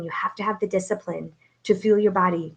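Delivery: usual speaking voice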